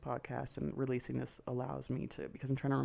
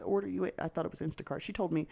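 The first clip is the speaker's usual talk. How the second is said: usual speaking voice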